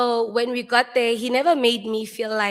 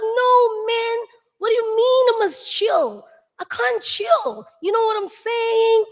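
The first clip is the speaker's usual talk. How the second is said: much higher and louder, near-shouting "I can't chill"; worked-up protest; 12 semitones higher, 5 dB louder than usual. Retold or imitated. Refusing to chill when told to, high excited protest. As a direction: protest like you truly can't calm down.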